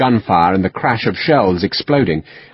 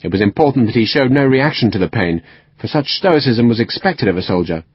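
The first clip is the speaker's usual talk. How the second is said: usual speaking voice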